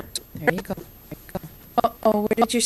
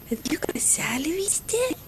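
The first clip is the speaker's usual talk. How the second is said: whisper; 2 semitones higher, 8 dB quieter than usual. Real, not acted. Mom whispers to baby.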